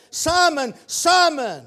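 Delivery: high, loud double call. Acted. Jesus calling out to Simon, high and loud. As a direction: summon him by name. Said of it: "That double call came to Simon Peter".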